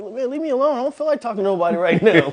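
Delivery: high, fast grumbling dog voice; grumpy dog voice. Retold or imitated. Trainer voicing his dog tired of admirers, high grumble. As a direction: voice the dog as fed up with fans. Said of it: "and I'm just like".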